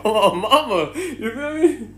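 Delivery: high laugh through the words. Real, not acted. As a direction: crack up on my mama. Laughing at own story.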